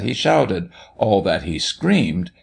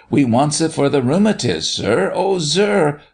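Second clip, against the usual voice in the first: put-on high, imploring child's voice; urgent pleading; 7 semitones higher than usual. A narrator's reading. Martha begging the doctor for the remedy, urgent plea. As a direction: plead with rising pitch to the end.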